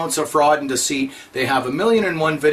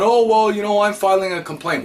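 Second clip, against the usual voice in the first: higher mock voice on "oh, well"; mocking whiny quote; 5 semitones higher than usual. Ridiculing people complaining to the Governor General, mock whine. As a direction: lampoon the smug complainer.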